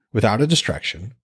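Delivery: plain reading voice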